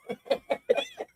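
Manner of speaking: laugh. Real, not acted. Laughing at Trump irony.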